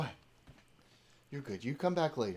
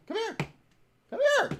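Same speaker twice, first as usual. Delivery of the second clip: sing-song high call to the dog; high-pitched doggy call; high pet-calling voice; 8 dB louder, faster than usual. Real, not acted. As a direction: coax the puppy over, high and bright.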